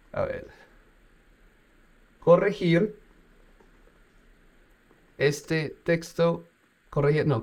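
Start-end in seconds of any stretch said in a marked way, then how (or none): 2.2-6.4 slow typing dictation, word by word. Shown as a demonstration. Dictating a prompt to the chatbot, slowly. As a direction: spell out each word as you type it.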